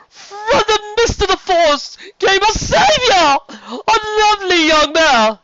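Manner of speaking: over-the-top friendly serpent duke; flamboyant sea serpent. Acted. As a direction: gush like a warm, showy nobleman. Serpent tells of savior.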